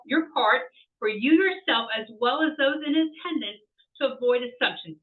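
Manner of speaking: usual speaking voice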